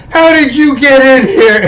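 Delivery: high, loud startled yell; retold shocked shout. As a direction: shout it at the intruder in disbelief. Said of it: "he looks at the mugger and goes".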